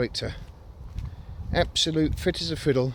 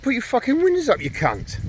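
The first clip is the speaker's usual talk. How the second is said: high, loud mock yell at a stranger; retold yell at a man complaining about the smell; 13 semitones higher, 6 dB louder than usual. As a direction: bark it the way you yelled back then.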